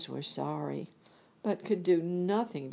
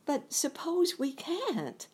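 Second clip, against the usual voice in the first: put-on high, slow worried girl; a small girl asking anxiously; lifted girlish voice, slow; Dorothy's voice; 12 semitones higher than usual. Dorothy's reply, quoted in the story.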